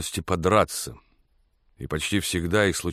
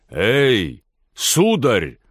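loud, high narrated call; shouting after someone; 3 semitones higher, 5 dB louder, much slower than usual. A narrator's reading. Blacksmith to the carriage driver, shouting after him. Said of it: "закричал кузнец вслед коляски".

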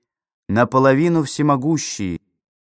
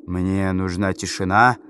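low, slow guttural voice; low register drop; 5 semitones lower than usual. Acted. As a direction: drop deep into the belly. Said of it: "утробным голосом".

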